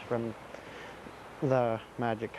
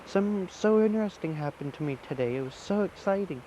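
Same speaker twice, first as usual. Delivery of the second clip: high, breathless rush to tell grandma; bursting with news, calling for his grandma; excited; 8 semitones higher than usual. Acted. Boy runs home to grandma, high excited calling. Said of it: "and he goes".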